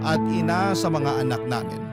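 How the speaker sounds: usual speaking voice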